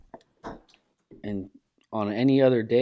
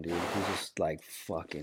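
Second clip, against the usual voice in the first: ordinary talk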